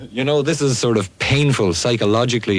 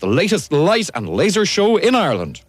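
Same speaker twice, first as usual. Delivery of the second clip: upbeat disco ad voice, pitched up; ad read; 7 semitones higher than usual. Acted. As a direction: sell the club night like a radio ad. Radio ad read in studio.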